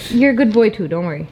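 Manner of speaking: ordinary talk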